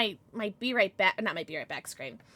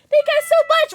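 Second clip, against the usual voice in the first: high, loud squeal of thanks; thrilled high-pitched yell; excited thanks; 12 semitones higher, 8 dB louder, faster than usual. Real, not acted. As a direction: shout it with delight. Thanking followers.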